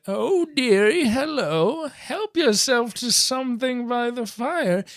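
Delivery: put-on high, sing-song old granny; put-on sweet little-old-lady welcome; mimicked grandma voice. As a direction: coo it like a fairy-tale grandma. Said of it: "and her voice coming out".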